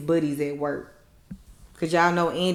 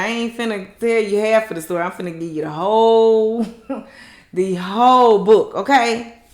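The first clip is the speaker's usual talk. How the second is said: sassy declaration; 2 semitones higher, 6 dB louder than usual. Retold or imitated. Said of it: "She said".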